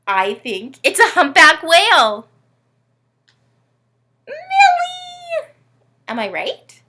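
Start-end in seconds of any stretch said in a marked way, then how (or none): 0.1-2.3 loud, eager guess; excited guess. Pretend. Host guessing the puzzle for Millie, loud and eager. 4.3-5.5 high, loud cry of the name; mock outraged cry. Host scolding the puppet Millie, high loud cry.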